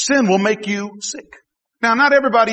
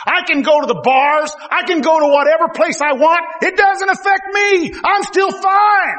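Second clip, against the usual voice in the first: mock careless youth; 6 semitones higher than usual. Preacher imitating young sinners, mock boastful bravado. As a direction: voice a reckless young person. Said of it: "you say".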